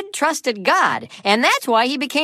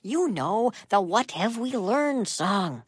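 whisper; 2 semitones lower, 8 dB quieter than usual. Acted. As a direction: keep it a secret whisper. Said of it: "why are you whispering?".

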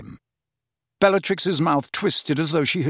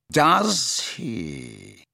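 delicate disbelief; 3 semitones higher than usual. A narrator's reading. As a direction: let doubt slide down through the words. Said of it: "delicately inflected to suggest his disbelief".

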